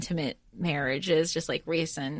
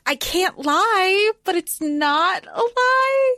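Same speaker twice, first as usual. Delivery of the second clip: high, drawn-out, louder protest; retold sly justification; 12 semitones higher, 5 dB louder, slower than usual. Fairy defending a technical truth, high slow mock justification. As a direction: stretch each word out high, splitting hairs.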